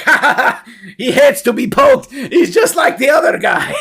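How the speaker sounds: high, loud goblin cackling; jeering goblins. Acted. Goblins mock the poked ogre, jeering. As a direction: laugh cruelly in a high goblin voice. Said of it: "And the goblins don't seem".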